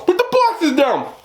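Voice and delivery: high, stretched-out shouted command; imitated yelling. Homeowner ordering delivery lady to stop, imitated yell. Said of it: "So he yells".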